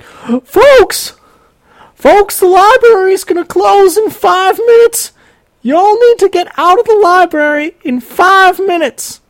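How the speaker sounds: very high, loud Southern-tinged holler; high-pitched, drawn-out loudspeaker announcement, hammed up; exaggerated announcer woman. Library worker announcing closing over the loudspeaker, exaggerated high holler. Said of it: "slight exaggeration of the way she talked".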